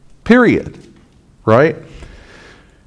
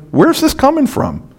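bewildered; 9 semitones higher than usual. Retold or imitated. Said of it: "And you're going".

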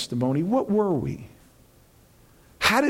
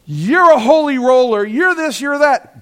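much higher and louder scornful yell; hateful jeers; 8 semitones higher, 9 dB louder than usual. Retold or imitated. The darkness jeering at a believer, shouted. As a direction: hurl the insult. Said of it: "darkness hates the light and they go".

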